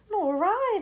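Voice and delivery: high, loud little voice; a shaken ladybird. Acted. Ladybird agreeing, shaken.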